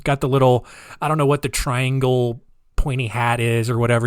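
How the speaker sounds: ordinary talk, nothing marked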